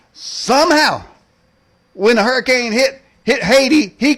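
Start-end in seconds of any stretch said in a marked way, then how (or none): 0.1-1.0 somehow, drawn out with suspense. Acted. Repeats word, high, drawn out.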